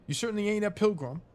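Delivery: an English caretaker voice, roused and cross; English accent, telling off an intruder. Acted. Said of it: "shift into a, an English accent".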